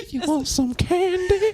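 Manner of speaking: very high, slow sing-song lure; sweet creepy coaxing. Pretend. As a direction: coo it like a creepy stranger offering candy. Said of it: "Sounds so creepy".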